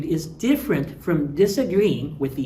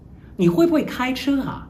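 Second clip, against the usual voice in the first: high, doubting 啊 question; incredulous question; 9 semitones higher than usual. Shown as a demonstration. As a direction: ask as if they surely can't drive. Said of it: "when I add an "a" to it".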